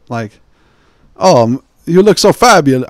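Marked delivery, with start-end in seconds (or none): 1.2-2.9 louder, higher campy French accent, only half done. Shown as a demonstration. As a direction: gush it like a flamboyant Frenchman.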